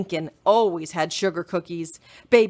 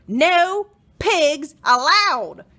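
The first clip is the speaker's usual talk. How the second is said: loud, slow, higher yell; angry slow shout; 9 semitones higher, 6 dB louder, much slower than usual. Acted. Eugenia shouting while chasing the pig. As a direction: drag out each word in fury. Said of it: "Eugenia shouted".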